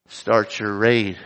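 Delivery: ordinary delivery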